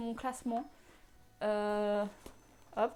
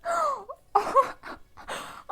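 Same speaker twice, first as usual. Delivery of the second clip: high, loud shocked gasp; sharp gasp; 14 semitones higher, 7 dB louder than usual. Real, not acted. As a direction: gasp at the awful smell. Sniffing a foul tea. (souffle coupé de dégoût)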